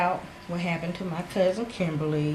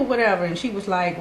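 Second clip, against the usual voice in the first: ordinary delivery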